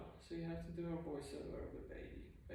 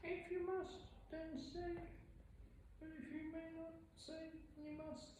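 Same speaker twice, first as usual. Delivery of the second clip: high, soft, wise little alien voice; Yoda voice; 11 semitones higher, 7 dB quieter than usual. Pretend. Vlogger doing a playful Yoda voice. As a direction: play the tiny wise master, raised pitch and hushed. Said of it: "something, something, Yoda".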